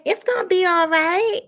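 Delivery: lightly voiced high reassurance; a hint of a preachy young niece; mimicking niece. Niece comforting grandma at casket, lightly mocked high voice.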